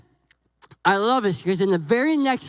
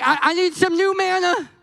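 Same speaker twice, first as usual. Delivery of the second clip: mock needy; 7 semitones higher than usual. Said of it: "We're like".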